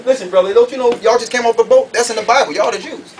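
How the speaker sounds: normal talking voice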